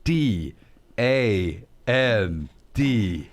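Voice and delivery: spelled out slowly, low and deliberate; slow dictation. Shown as a demonstration. Host reading a promo code to listeners, slow and deliberate. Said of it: "get your pens out".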